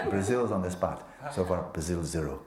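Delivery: a real laugh carrying every word; playful banter broken up by laughter. Real, not acted. Joking about being put on the spot.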